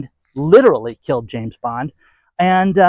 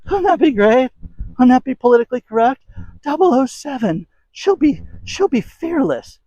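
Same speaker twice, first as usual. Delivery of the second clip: sarcastic mock cheer; 8 semitones higher than usual. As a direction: gush over it sarcastically. Sarcasm about a female James Bond.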